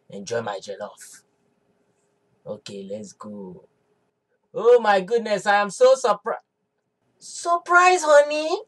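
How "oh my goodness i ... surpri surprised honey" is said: loud, overdone gasp of delight at the party; hammed-up surprise, playing it big; faked surprise. Husband walking in pretends to be surprised, loudly. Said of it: "act surprised".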